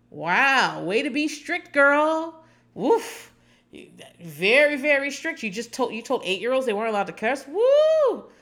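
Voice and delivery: mock her strictness with over-the-top cheers; sarcastic mock praise. Real, not acted.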